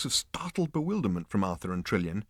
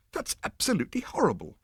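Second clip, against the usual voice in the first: much higher appalled exclamation; exclaiming; 11 semitones higher than usual. A narrator's reading. Arthur appalled at the talking dish, higher exclamation. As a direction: recoil in disgust. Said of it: "exclaimed Arthur".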